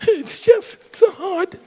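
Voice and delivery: very high, crying wail of self-pity; whiny self-pity. Retold or imitated. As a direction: play the ego as a sobbing victim. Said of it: "Try and tell me that your spirit self talks like that".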